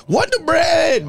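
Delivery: high, drawn-out mock shout; very loud, high imitation of someone screaming; imitated scream. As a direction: holler it like a heckling friend, stretched out. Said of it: "B just be screaming".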